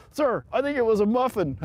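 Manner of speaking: high, loud recruit's reply; imitated high-pitched answer to a superior; retold sheepish recruit. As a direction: pipe up to the drill sergeant. Retold recruit answers looming sergeant.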